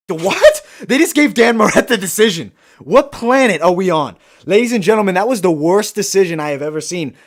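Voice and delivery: shocked, high and loud outburst; freaking out. Real, not acted. Streamer reacting live to a shock decision, freaking out. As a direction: erupt in loud disbelief. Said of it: "freaking out about that decision".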